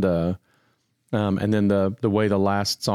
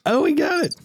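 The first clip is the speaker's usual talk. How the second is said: excited. Real, not acted. Sound problem suddenly fixed.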